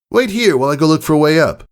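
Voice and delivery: high, fast tomboy voice; quick tomboy voice. Acted. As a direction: rattle it off before dashing away. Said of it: "Rainbow Dash said".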